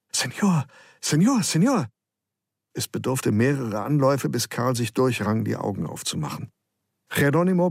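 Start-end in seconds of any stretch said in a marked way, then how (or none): 0.1-1.9 put-on high, excited boy's call; breathlessly eager calling; bubbling over. A narrator's reading. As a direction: burst out with it, pitched well up. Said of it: "Es sprudelte aus Geronimo heraus".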